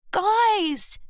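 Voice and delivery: high pleading call of Guys!; high begging cry to her arguing family. A narrator's reading. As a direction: beg them to stop, voice jumping up. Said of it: "Scarlett begged".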